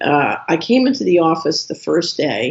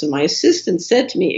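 ordinary talk

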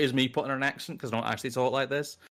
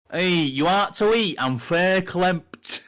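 broad Northern English, loud, higher and drawn right out; Northern English dialect; 6 semitones higher, 7 dB louder, much slower than usual. Retold or imitated. Man walks up to new pie shop girl, thick Northern English. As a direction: put on a slow, loud old Northern English accent. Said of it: "older Northern people have a lot of their own slang".

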